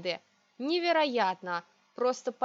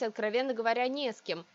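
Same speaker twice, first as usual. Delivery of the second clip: ordinary delivery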